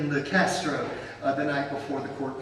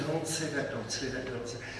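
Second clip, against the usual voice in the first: low, soft, fading urgent aside; retold discreet hushing; hushed warning; 5 semitones lower, 9 dB quieter than usual. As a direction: mutter a repeated caution under your breath. Attorney privately warns him, retold.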